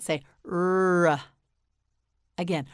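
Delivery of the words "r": "r" as a long held rrr-uh, not the letter's name; isolated R sound. Shown as a demonstration. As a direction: hold the sound of "r", releasing into uh. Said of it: "The letter R makes the sound".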